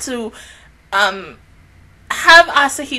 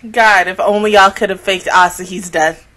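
normal talking voice

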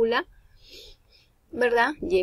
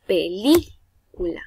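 "Película" with LÍ raised and stretched; slow "Película", leaning hard on LÍ; stress on LÍ; 4 dB louder, slower than usual. Shown as a demonstration. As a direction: punch the second syllable, LÍ, in "Película". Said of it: "en la I".

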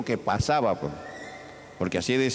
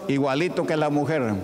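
ordinary talk, nothing marked

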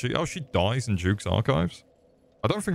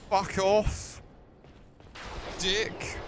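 annoyed snap; 11 semitones higher, 5 dB quieter, much slower than usual. Real, not acted. Annoyed at the postman's interruption.

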